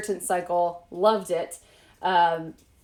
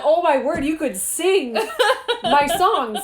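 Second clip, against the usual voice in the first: much higher, louder thrilled exclamation with a laugh; excited squeal; 8 semitones higher, 5 dB louder, slower than usual. Retold or imitated. Author retelling reaction to narrator offering to sing, thrilled mock squeal. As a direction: replay her delight at the offer, laughing. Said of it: "I was like".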